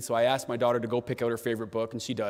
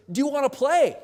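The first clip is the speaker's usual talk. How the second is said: put-on high, loud little-kid voice; eager child; 13 semitones higher, 5 dB louder than usual. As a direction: ask like a small child begging for a game. Daughter voiced in story.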